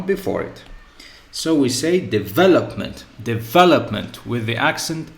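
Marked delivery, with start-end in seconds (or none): 1.3-5.1 teacher models de-VE-lop-ment with the second syllable stressed. Shown as a demonstration. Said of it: "with the stress or accentuation on the v".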